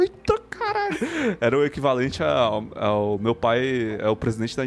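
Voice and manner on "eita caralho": shocked exclamation. Retold or imitated. Kids react to Henry Cavill.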